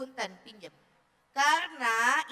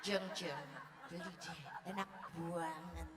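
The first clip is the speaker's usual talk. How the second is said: low, hushed gossip; gossiping neighbour; 9 semitones lower, 16 dB quieter than usual. Acted. Neighbour gossiping to a mother, hushed and confidential. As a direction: confide it very softly and low, like a secret tip. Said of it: "tergantung gibahan tetangga".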